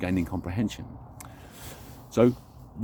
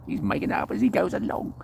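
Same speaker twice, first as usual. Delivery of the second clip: much higher put-on voice mocking himself; a sceptic's high mock aside about him; 11 semitones higher than usual. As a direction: play a teasing heckler, pitched way up.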